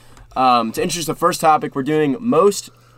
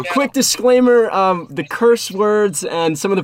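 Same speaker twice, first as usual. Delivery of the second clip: radio host voice; 7 semitones higher, 4 dB louder, slower than usual. Acted. Radio-style disclaimer to caller.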